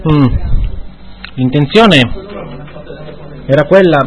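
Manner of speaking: parla masticando; bocca piena. Real, not acted. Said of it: "ha la bocca totalmente piena". (con la bocca piena)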